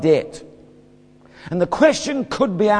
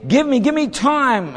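pleading; 8 semitones higher than usual. Retold or imitated. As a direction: plead for mercy in a rush. Poor fellow begs for time.

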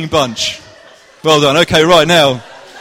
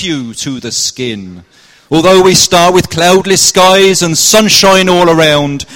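loud, lilting Cornish storyteller verse; best Cornish accent; 5 semitones higher, 10 dB louder, slower than usual. Shown as a demonstration. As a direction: declaim the rhyme in a broad put-on Cornish accent. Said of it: "This is my best Cornish accent".

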